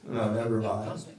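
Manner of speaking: ordinary delivery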